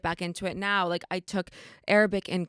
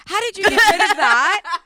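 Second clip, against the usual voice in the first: laughing question; 11 semitones higher, 8 dB louder than usual. Real, not acted. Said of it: "laughing while asking".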